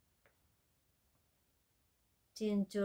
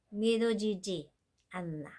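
fast rattled-off phrase; much faster than usual. Shown as a demonstration. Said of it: "It's unbelievable".

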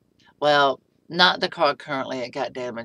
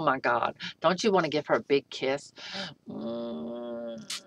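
ordinary talk, nothing marked

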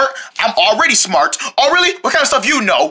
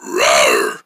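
very low growled roar; tiger roar. Acted. Quiz game with a friend, a low tiger roar. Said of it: "What about this one".

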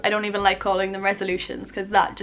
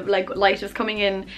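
ordinary talk, nothing marked

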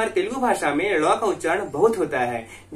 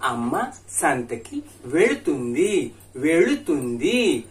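slow drill; 2 semitones lower, slower than usual. Shown as a demonstration. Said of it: "उसको ध्यान से सुनिएगा".

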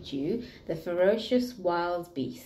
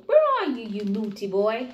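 cross mum; 2 semitones higher, 6 dB louder than usual. Acted. Mum calling her missing son, loud and cross. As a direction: tell off the naughty boy.

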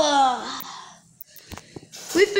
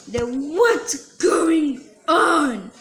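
usual speaking voice

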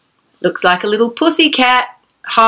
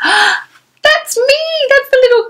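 gasp, then a much higher delighted exclaim; gasping surprise. Pretend. Storyteller reading the girl's line on spotting herself, high gasping delight. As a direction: pretend to be astonished and thrilled.

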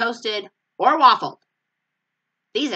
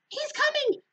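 excited squeal. Acted. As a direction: squeal it, climbing up in pitch. Linus repeats his cry.

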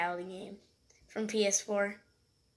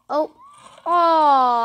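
usual speaking voice

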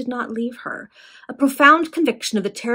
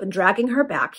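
plain reading voice

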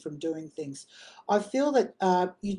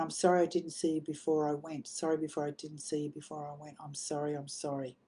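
softly relayed apology, fading lower; hushed apology; 3 semitones lower, 8 dB quieter than usual. Retold or imitated. Medium passing on a dead friend's apology, hushed and tender. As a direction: let the repeated apology sink away quietly.

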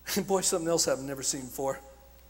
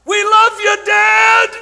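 much louder, high screaming call; screamed out; 18 dB louder, much slower than usual. Retold or imitated. Girls in the crowd screaming to the speaker, loud high yell. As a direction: yell it like fans across an arena. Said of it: "they screamed out".

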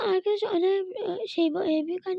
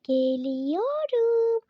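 normal talking voice

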